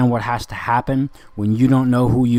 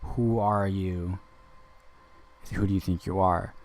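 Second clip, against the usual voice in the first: quiet power; 2 semitones lower, 6 dB quieter than usual. Retold or imitated. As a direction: say it softly, like you hold the power. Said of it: "I have more power than you".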